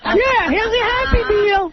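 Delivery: slow, very high squeaky drive-thru voice; high-pitched Eric voice. Retold or imitated. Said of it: "sound like that".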